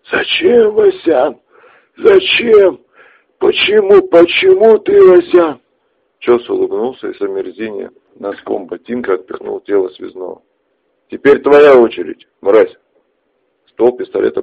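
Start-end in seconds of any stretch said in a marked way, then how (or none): 0.1-5.6 high anguished wailing; sobbing cry. Acted. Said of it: "Его тело стало содрогаться". 11.1-12.8 menacing. Acted. Ches turns gun on Artyom.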